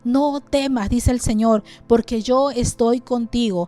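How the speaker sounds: loud, ringing Bible reading over music; fervent scripture reading. Acted. Preacher reading Isaiah to his church, loud and fervent. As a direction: proclaim the verses to the church.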